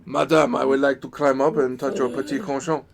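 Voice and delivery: hammed-up French seducer; French accent. Player reviving a character from the French game, French accent. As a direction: lay on a French accent for a suggestive line. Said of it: "everybody would do a French accent".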